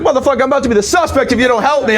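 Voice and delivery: high, fast, desperate; mock frantic victim, high and fast; bleeding man. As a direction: rush it like a man holding his guts. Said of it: "He's like".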